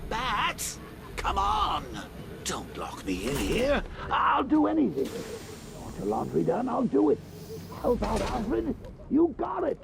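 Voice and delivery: high, frantic theatrical begging; pleading. Acted. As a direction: beg wildly to be let out. Villain begs not to be locked in.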